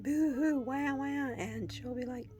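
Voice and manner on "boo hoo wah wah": imitating her own sobbing, raised and louder; hammed-up wah-wah of someone weeping; mock boo-hoo crying. Telling her family how she cries, mock boo-hoo sobs. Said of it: "'cause I'll be crying".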